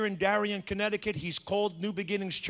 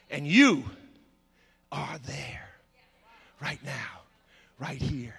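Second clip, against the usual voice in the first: hushed preaching; 7 semitones lower, slower than usual. Real, not acted.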